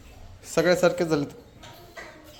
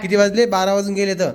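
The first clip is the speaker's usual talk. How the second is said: scolding; 5 semitones higher, 6 dB louder than usual. Real, not acted. Scolding about time.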